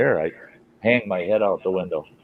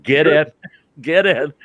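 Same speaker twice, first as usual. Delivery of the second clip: higher, raised command; sharp, raised mock order; retold order; 7 semitones higher, 4 dB louder than usual. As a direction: order them in, imitating a cop. Cop ordering two people into car.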